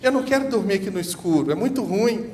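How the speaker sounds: put-on a scared boy pleading with his father; crying boy. Boy telling his father he fears the dark, mild mock crying.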